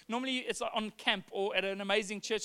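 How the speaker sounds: normal talking voice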